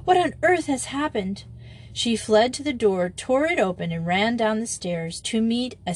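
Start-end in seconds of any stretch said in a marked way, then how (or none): 0.0-1.4 high-pitched, alarmed question; acted astonishment, pitched up; startled. A narrator's reading. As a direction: ask it in shock after a shriek. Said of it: "gasped Madge".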